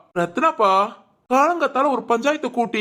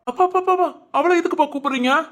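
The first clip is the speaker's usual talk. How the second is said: much higher, urgent pleading; alarmed protest; 7 semitones higher, faster than usual. Acted. Son begs his father not to call his wife, alarmed and pitched much higher. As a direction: panicky pleas to stop him.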